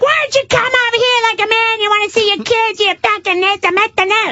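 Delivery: very high, mocking wife voice; yelling wife. Wife challenges her husband to come over, high-pitched mock tirade. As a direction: imitate a wife haranguing in a piercingly high pitch. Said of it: "So the wife is like".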